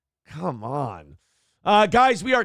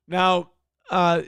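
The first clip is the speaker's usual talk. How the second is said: ordinary talk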